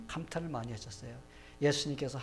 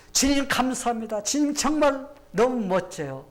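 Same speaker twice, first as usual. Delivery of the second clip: loud, high, heartfelt praise; exclaiming in admiration; 8 semitones higher, 10 dB louder than usual. Shown as a demonstration. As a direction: cry out thanks, thrilled and amazed. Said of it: "보면서 감탄합시다".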